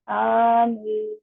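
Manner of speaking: slow, drawn-out melodic tilawat; Quran recitation. Acted. Teacher recites Fatiha for students to copy, slow melodic chant. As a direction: chant each verse slowly, stretching the vowels.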